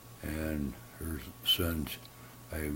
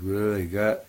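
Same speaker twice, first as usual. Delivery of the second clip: raccoon voice; 5 semitones higher, 8 dB louder than usual. Acted. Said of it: "the raccoon goes".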